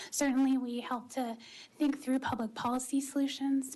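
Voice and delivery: normal talking voice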